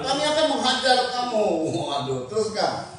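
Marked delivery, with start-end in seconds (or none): 0.1-1.5 high-pitched enemy threat; shouted threat. Acted. Philistine guards threaten Jonathan and his bearer, shouted threat. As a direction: call the threat out to them. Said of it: "berseru kepada Yonatan".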